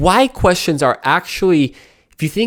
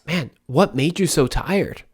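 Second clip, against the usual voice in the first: soft caring question; 5 dB quieter, slower than usual. Shown as a demonstration. Friend after a hard week, asked softly and kindly. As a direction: ask gently, softer than the why version. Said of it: "But instead of".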